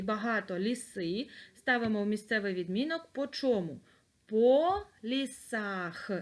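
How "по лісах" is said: slow, stressing "По лісах" syllable by syllable; slow dictation. Shown as a demonstration. Teacher dictating "По лісах" slowly to pupils. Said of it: "Записуємо".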